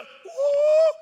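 very high squealed ooh; mock ooh. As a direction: squeal in fake delight. Reacting to a sales pitch.

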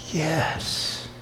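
whispered, barely audible reply; soft shy whisper of a boy; whispered yes. Retold or imitated. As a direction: breathe the word like a shy kid. Boy answering quietly.

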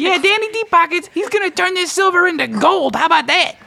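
very high, squeaky hustler voice. Acted. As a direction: play a slick, grinning money changer. Money changer boasts.

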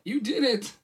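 high, loud delighted cheer; excited. A narrator's reading. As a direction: burst out celebrating a friend's win. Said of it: "hopping up and down with excitement".

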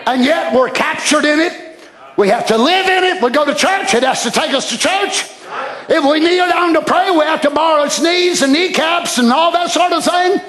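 driving, emphatic pulpit cadence; impassioned sermon delivery; preaching. Acted. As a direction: pile up the points to the congregation. Preaches at full force.

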